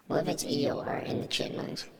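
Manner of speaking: normal talking voice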